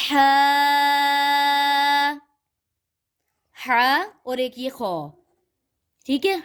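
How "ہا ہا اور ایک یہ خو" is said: ہا pushed out from the throat; throaty ha. Shown as a demonstration. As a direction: breathe ہا up from the throat. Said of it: "حلق سے نکالنا ہے اس کو".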